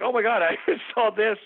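retold amazement through a laugh; laughing disbelief. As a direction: laugh at what you remember. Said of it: "And I was like".

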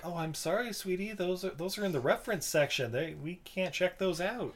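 sweet librarian voice. Retold or imitated. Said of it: "And like".

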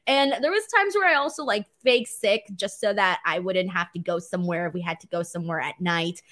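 normal talking voice

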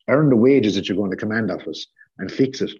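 usual speaking voice

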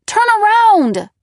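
high, loud panicked scream. A narrator's reading. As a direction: scream it, frantic. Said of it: "and then I screamed".

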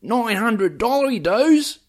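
high, slow, hammy fake Aussie accent; American faking an Aussie accent; bad Australian accent. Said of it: "imitate an American doing an Australian accent".

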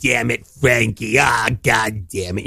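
slow, drawn-out mock exasperation; impression of parent. Cohost doing friend's parents, mock exasperation. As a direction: imitate a fed-up parent scolding the kid. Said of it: "when I do your parents".

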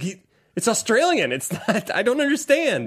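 high-pitched imitation of uncomprehending parents; mock baffled parents. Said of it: "and they were like".

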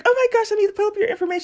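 high, fast, flustered gush; flustered rush. Retold or imitated. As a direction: rattle it off in a hurry. Voicing the flustered caller.

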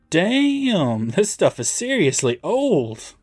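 high, drawn-out "damn"; impressed disbelief. Acted. Recapper voicing Manny reading an old bottle, amazed. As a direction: stretch the opening word up high in amazement. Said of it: "Manny takes another look at the bottle".

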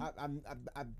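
stumbling over the first words; stuttering. Real, not acted. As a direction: trip over the start of the sentence. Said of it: "I'm stuttering".

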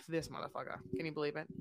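low, soft disbelieving mutter; muttered side eye. Retold or imitated. Imitating her side eye, a soft low mutter. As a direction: mutter it sideways, unimpressed. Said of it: "side eye of being like".

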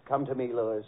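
low-pitched romantic murmur, acted; imitated seductress. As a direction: coax a lover, low and tender. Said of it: "Marilyn looks into your eyes and says".

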